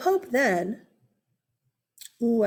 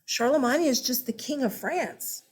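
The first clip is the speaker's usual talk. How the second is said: ordinary delivery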